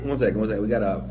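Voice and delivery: ordinary talk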